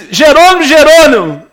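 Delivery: high, loud heavenly call. Acted. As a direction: boom it down from the sky. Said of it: "ouviu uma voz assim".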